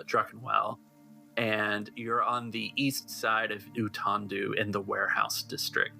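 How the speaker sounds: usual speaking voice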